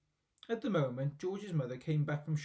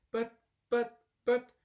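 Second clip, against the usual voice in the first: high, loud, shocked stammer; 8 semitones higher, 7 dB louder, slower than usual. A narrator's reading. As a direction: sputter in disbelief. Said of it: "stammered George's mother".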